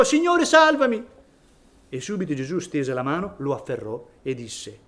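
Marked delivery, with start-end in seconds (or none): 0.0-1.0 loud, high desperate cry for help; panicked high shout to the Lord. Acted. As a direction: shout it like a man sinking in the water. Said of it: "Gridò".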